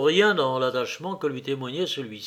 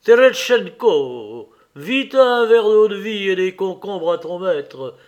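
loud, resonant, showy command; sonorous shout; 5 semitones higher, 8 dB louder than usual. Acted. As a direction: bellow for a servant, relishing the sound. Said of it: "en s'amusant lui-même de la sonorité de sa voix".

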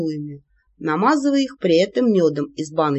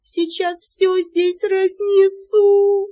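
high, slow cracking falsetto; thin falsetto; 12 semitones higher, 3 dB louder, much slower than usual. Acted. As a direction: break into a squeaky falsetto. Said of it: "сорвалась на тонкий фальцет".

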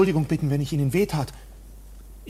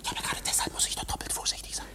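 fast voiceless whisper; hurried whisper; 8 dB quieter, faster than usual. Acted. K whispers back his caution to the clerk. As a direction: rush it out under your breath.

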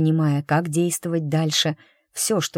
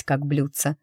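ordinary delivery, nothing marked

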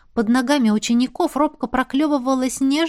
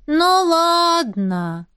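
reluctant sigh; 6 semitones higher, much slower than usual. Acted. Pixie reluctantly agrees.

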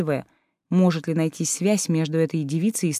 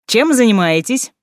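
bright, loud, higher cheerful call; cheerful greeting; 6 semitones higher, 7 dB louder than usual. Acted. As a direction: breeze in with a happy hello. Cheerful Deimos arrives.

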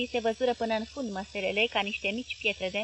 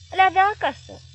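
loud, much higher growl; 9 semitones higher, 11 dB louder than usual. Acted. Husband snapping back at his wife, loud growl. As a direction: snarl it back. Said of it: "mărâi Paraschiv".

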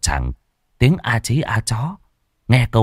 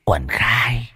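Chinese. A narrator's reading. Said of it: "bằng tiếng Trung Quốc".

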